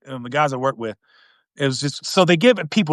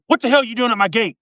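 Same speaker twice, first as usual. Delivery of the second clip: mock combative challenge, pitched up; 9 semitones higher than usual. Philly worker challenging an unknown man at the gate, mock combative. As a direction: imitate a local confronting a stranger. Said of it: "it's just, like, real combative".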